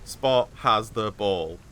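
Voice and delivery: slow, deliberate word-by-word reading. Shown as a demonstration. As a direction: sound out each word like an early reader. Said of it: "read it and be like".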